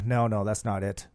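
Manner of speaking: usual speaking voice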